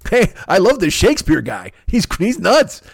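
a hint of Tom Sizemore. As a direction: rave like a manic cop. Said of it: "Fucking Tom Sizemore in True Romance".

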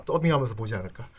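ordinary talk

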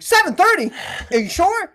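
high-pitched, disbelieving exclamation; incredulous exclamation. Shown as a demonstration. Texter reacting to a meeting time, incredulous exclamation. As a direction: exclaim it as a startled check.

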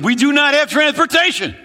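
high, loud counting off; loud retort. Retold or imitated. The friend answering the panicked pastor, a loud retort. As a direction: shout back the next reason.

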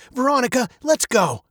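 high, firm fatherly command; snapped order. A narrator's reading. Father hurries daughter out of the store, snapping. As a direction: order your child away, mildly sharp. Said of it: "her father snapped".

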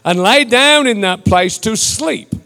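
declamatory Bible reading with stretched key words; scripture reading. Real, not acted. Preacher reads Genesis from the King James, declamatory delivery. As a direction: proclaim the passage in measured, emphatic phrases.

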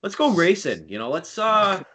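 high, loud New England guy voice; coworker impression. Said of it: "he's got this thing now where he's like".

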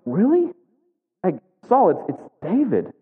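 incredulous priest. Retold or imitated. Priest incredulous before the king.

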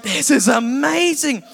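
gushing delight over movies; giddy amazement. Retold or imitated. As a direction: savor the wonder. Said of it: "I'm like".

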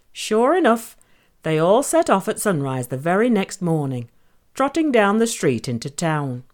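ordinary delivery

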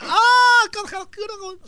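high, loud gasp breaking into a stutter; a scandalised old woman who can't get the word out; mock shocked old lady. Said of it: "Y la gente, la vieja". (vieja escandalizada tartamudeando)

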